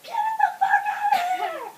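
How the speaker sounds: high, loud mock scream. Woman in labor yelling at baby, screaming. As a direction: scream it high, as if mid-push. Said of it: "the person who was screaming".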